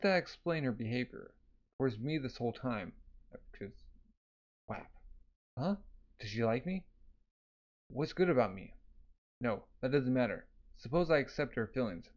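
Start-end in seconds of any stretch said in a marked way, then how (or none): none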